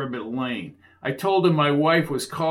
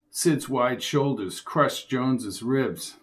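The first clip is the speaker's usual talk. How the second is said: plain reading voice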